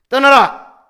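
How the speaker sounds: high, explosive shout; thundering rebuke. Acted. God the Father rebuking his people, thundering shout. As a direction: bellow it as a sudden stern command. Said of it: "이렇게 호통을 치고 계시는 거예요".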